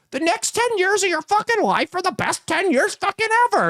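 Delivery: very high, squeaky hype-man yelling; mocking squeaky-voiced impression; Gary Vaynerchuk. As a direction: squeal it like a motivational hustler. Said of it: "he's got the squeaky voice".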